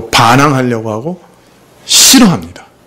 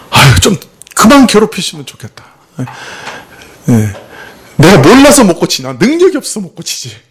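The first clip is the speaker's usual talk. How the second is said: irritated grumbling; 5 semitones higher than usual. Retold or imitated. Pastor acting out his own annoyed reaction to criticism, exasperated. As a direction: grumble back like someone sick of being scolded. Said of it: "막 화가 나고 막 그래요".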